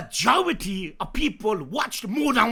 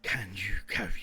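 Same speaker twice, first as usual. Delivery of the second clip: whisper; 9 dB quieter than usual. Real, not acted. Quoting the show quietly.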